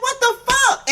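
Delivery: high squeaky what the fuck; high disbelief. Retold or imitated. Vlogger stunned by what Roland Ray said, voice high. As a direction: pitch it way up, stunned. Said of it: "It's like".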